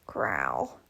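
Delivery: deep animal growl; low growl. Acted. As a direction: growl like an angry dog. Growl sound.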